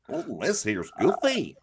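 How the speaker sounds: swooping cartoon goofball voice; second go at a silly cartoon voice; Goofy. Retrying the cartoon impression for co-hosts, swooping and goofy. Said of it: "That sounds better".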